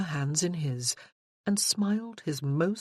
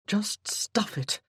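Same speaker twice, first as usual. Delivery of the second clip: breathy whisper, higher, slowly; whispered repeat; 4 semitones higher, slower than usual. Acted. Josh whispers to Freya demanding the truth, hushed and slow. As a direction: drop to a whisper to drive it home.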